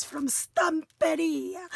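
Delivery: Stamperia stretched out slowly; showy brand-name flourish. Shown as a demonstration. Crafter who has to say the maker's name like that, showy flourish. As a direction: linger playfully on Stamperia. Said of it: "I have to say it like that".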